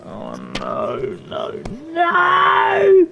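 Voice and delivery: despairing cry. Real, not acted. Gamer watching the round slip away, despairing cry. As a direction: wail it as the round slips away. Losing a card game round.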